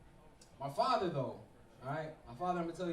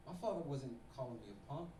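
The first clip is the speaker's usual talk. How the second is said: usual speaking voice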